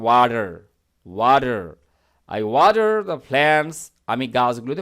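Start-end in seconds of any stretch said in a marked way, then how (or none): 0.0-1.8 ওয়াটার said twice with tapped d and final r; American pronunciation. Shown as a demonstration. Teacher repeats the American form for learners, ওয়াটার with tapped d. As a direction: say ওয়াটার the American way, as "wah-der". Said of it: "এটা হচ্ছে আমেরিকার উচ্চারণ".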